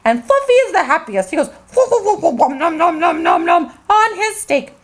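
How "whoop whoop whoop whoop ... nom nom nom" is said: giggly whoops into munching; excited dog chomping; happy whoops and noms. Acted. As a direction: gobble the steak with glee. Fluffy happily eating steak.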